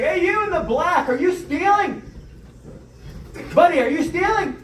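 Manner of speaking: mock clerk accusing a shoplifter; calling out. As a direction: clerk shouting suspiciously at customer. Clerk calls out to him.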